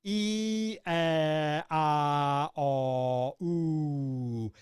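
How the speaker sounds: E, E, A, O, U each shaped wide and held; exaggerated vowels. Shown as a demonstration. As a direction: open the mouth fully on every vowel. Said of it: "So look what happens".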